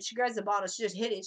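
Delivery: ordinary talk, nothing marked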